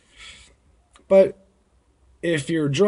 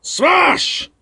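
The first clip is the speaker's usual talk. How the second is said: a high, loud shout of smash; mock smash; 6 dB louder than usual. Bouncer acts out a punch, high loud mock shout. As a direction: belt it out like a blow landing. Acting out hitting someone.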